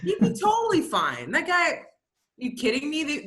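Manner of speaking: can you believe this guy; incredulous. Real, not acted. Incredulous agreement about the guy.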